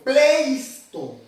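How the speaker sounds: pleisto drawn out, with a clear S before the T; pleisto, stretched to show the S; corrected with S. Shown as a demonstration. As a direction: sound out pleisto slowly as the correction. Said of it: "no pleito".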